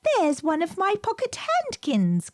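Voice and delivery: put-on a child's eager cry; Lucy's voice. A narrator's reading. Little Lucy exclaiming over her lost handkerchief. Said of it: "cried Lucy".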